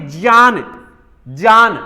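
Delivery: ज्ञान said gyan; ज्ञान with a gy sound; ज्ञान pronounced gyan; correct pronunciation. Shown as a demonstration. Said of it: "यानी कि जय है".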